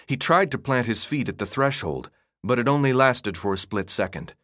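ordinary delivery

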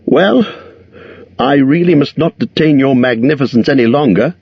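high, courteous hobbit voice; polite hobbit. A narrator's reading. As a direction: edge away politely. Bilbo excuses himself.

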